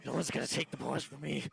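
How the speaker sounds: agitated refusal, voice pushed up; frantic protest. Acted. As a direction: protect the boys, desperately. Survivor frantic about losing his boys.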